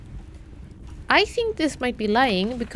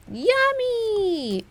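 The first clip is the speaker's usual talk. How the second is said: playful squeal; 10 semitones higher than usual. Pretend. Finds aquarium, jokes about food.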